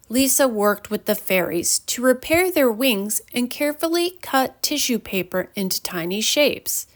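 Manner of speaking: plain reading voice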